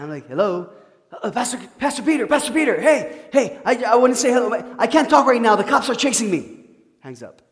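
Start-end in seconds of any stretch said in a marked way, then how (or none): 0.3-6.7 much higher, louder, rushed and breathless; frantic phone call. Retold or imitated. Fleeing man calling his pastor mid-chase, frantic, much higher and louder. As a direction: gasp it out like he's on the run. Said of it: "this is how the phone call sounded".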